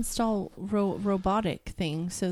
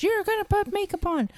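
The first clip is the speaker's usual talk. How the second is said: high, bossy mother voice; mocking high-pitched mom voice; imitating mom; 11 semitones higher than usual. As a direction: mimic mom ordering her daughter around. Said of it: "And she goes".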